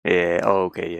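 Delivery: ordinary delivery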